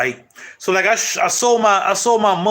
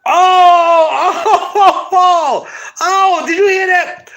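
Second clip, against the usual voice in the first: excited; 11 semitones higher, 5 dB louder, much slower than usual. Real, not acted. Thrilled by his own new slogan.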